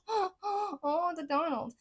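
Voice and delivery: high, mock-wailing lament; mock dismay. Reader jokes about the sky falling on the Donald, mock dismay. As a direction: put on a laughing, high-pitched 'oh no'.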